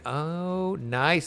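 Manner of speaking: usual speaking voice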